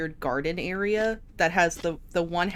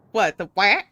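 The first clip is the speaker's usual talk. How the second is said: usual speaking voice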